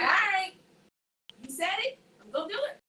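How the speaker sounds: a daring, pumped-up retort; bold reply. Retold or imitated. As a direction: rise to the challenge, voice up. Retold defiant reply, raised voice.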